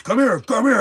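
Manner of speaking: high, loud mock cop bark; sneering imitation of a small-town cop; mocking tough cop. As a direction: ham up the bossy cop calling him over. Cop calling him over.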